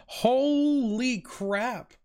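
high, very drawn-out amazement; stunned exclamation. Retold or imitated. Player reacting to Ellie stabbing the guy, drawn-out astonishment. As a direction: stretch it out in awed disbelief.

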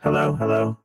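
ordinary delivery

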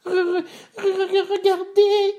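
very high stuttering squeak; stammering baby bear. A narrator's reading. Bébé Ours spotting someone in his bed, high stammer. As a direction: stutter the first syllable, then squeal. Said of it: "dit Bébé Ours en bégayant".

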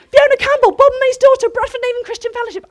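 retold frantic self-introduction; excited rush. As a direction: blurt her own name at them. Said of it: "like a mad woman".

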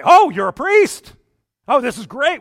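loud, high, delighted exclamation; excited discovery. Acted. Spies discovering the Levite is a priest, acted excitement. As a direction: burst out with acted delight, drawing out the opening.

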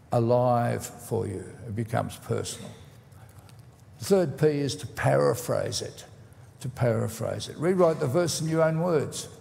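normal talking voice

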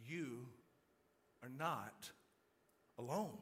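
soft, low, with long pauses between phrases; solemn reassurance. Real, not acted. Preacher looks all of you in the eye, solemn reassurance. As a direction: let each phrase land gently and low.

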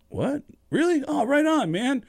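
high, starstruck disbelief; thrilled surprise. Retold or imitated. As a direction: react as if your idol just called. Singer thinks his idol is calling.

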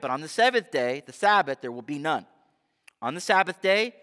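ordinary delivery

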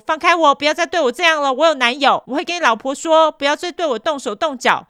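retelling a furious outburst; retold yell. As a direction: shove him off and shout. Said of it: "他就说".

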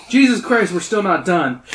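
loud high groan of disbelief; exasperated outburst. Real, not acted. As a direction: cry out at the endless book. Reacts to more pages.

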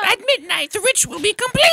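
camp evil cultist announcing the ritual; evil villain voice. Pretend. Mocking the sinister laugh.

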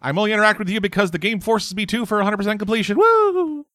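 put-on high cutesy anime girl voice; excited anime fan. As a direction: sing it high and sweet, then squeal. Voicing Violet's cutesy reply.

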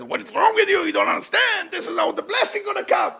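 high-pitched mock yell of the friend. Pilot friend scolding young Eliyahu, high mock yell. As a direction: squeal it like the insistent friend. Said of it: "They screamed at me".

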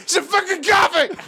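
high-pitched mock-furious yell; angry shout. Applicant demanding coffee from the interviewer, exploding in a high shout. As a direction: burst out shouting.

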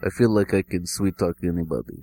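put-on low, soft Russian accent; Russian-accented job applicant. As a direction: sweet-talk in a Russian accent, quietly. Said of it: "Russian, Russian accent".

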